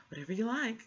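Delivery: imitating a nonchalant librarian. Librarian quoted dismissively.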